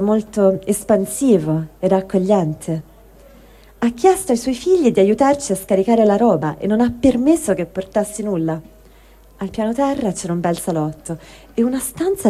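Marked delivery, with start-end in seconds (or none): none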